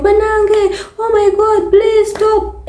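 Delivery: high, crying plea to stop; pleading cry. Retold or imitated. Women calling out to a man beating his wife, high crying plea. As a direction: beg him to stop, half in tears.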